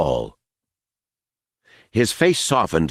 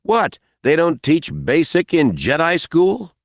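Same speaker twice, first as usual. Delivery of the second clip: smirking; 9 semitones higher than usual. Acted. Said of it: "Smiley smirked".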